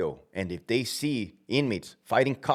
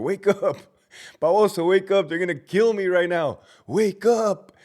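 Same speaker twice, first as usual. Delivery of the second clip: high, pleading mock shout; half-laughing, high-pitched alarm; urgent calling out; 9 semitones higher, 4 dB louder than usual. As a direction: call up to someone asleep on watch. Acted calling to sleeping gunner.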